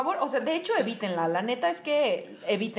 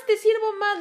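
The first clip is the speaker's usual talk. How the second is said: high, eager mock offer of more food; mock pushy relative; 9 semitones higher, 4 dB louder than usual. Mocking the relative who serves the fat girl more, high and eager. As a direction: chirp it like an aunt piling up the plate.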